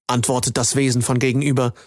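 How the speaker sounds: ordinary delivery, nothing marked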